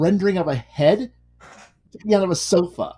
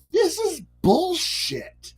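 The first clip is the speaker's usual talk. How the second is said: disgusted; 12 semitones higher, 3 dB quieter, much slower than usual. Retold or imitated. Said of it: "And I'm like".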